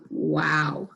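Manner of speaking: low, slow, marvelling aside; imagined onlooker's voice. Acted. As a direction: take it in slowly, mildly amazed. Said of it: "might sound a little bit like".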